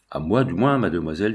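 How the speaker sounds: usual speaking voice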